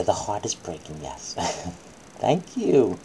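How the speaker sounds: usual speaking voice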